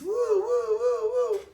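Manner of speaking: high, loud wailing "woo woo" siren; police siren imitation. Police siren in retold story.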